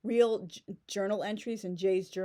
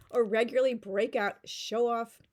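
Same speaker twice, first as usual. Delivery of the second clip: usual speaking voice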